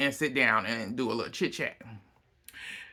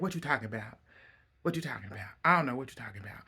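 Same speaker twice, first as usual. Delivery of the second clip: mom denying it; 7 dB quieter, much faster than usual. Retold or imitated.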